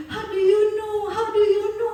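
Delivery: high, imitated crying plea; high-pitched retold weepy astonishment; imitated tearful. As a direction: mimic someone suddenly moved to tears. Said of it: "Tiba-tiba dia nangis".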